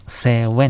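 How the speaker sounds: セウェン said the Thai way, w for v; Thai pronunciation. Shown as a demonstration. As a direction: pronounce セウェン as in Thai, w instead of v. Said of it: "タイ語でもう一度お願いします".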